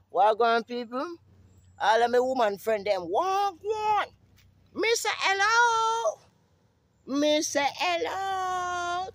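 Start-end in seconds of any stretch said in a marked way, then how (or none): 3.1-9.1 high, slow crying hello; high wailing greeting. Acted. Calling out to his woman friends in a high slow wail.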